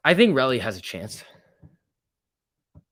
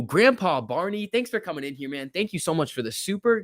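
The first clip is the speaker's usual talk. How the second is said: ordinary talk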